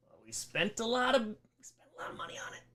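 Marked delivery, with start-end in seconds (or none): 1.3-2.6 unvoiced whispered aside. Real, not acted. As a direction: whisper it like a guilty secret. Whispered repeat of previous line.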